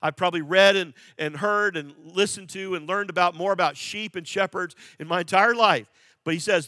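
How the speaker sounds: emphatic pulpit teaching; impassioned sermon. Acted. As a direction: lean in and press every point home. Animated teaching, raised voice.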